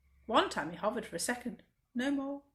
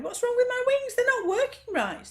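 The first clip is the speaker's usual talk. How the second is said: high, loud panicked wail; panicked cry; 13 semitones higher, 8 dB louder than usual. Acted. Butterfly wailing that his wings won't work. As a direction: cry out in alarm at broken wings. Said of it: "Ash cried".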